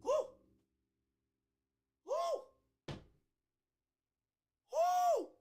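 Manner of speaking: high, rattled whoo after a fright; shaky high woo from a real scare; startled whoops. Real, not acted. Host spots a huge spider, startled high whoos. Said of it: "that scared the mess out of me".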